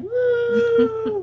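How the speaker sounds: high whooping cheer; excited cheer. Real, not acted. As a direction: let out a breathless whoo. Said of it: "I ran out of breath there doing my self-promotion".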